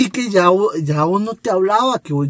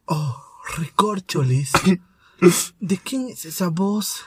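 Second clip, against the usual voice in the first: telenovela voice; 6 dB quieter, slower than usual. Acted. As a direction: ponder softly and theatrically, drawing the question out. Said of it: "una voz de novela".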